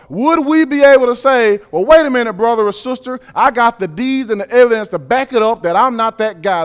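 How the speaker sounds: loud, high, driving sermon climax; preaching at full force. Acted. Pastor closes the sermon urging the congregation, loud and high. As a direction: pour it out over the congregation, building.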